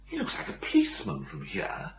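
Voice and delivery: soft, high whisper; whispered aside. Acted. Willie whispering to his friend about the policeman, a soft high whisper. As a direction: hush it so the cop won't hear.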